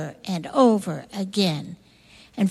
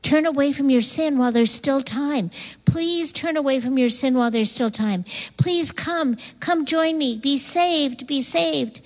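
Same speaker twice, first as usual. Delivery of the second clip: higher, imploring appeal; urgent pleading; 4 semitones higher than usual. Acted. Noah begging the people to repent, urgent pleading. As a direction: plead with them to come and be saved.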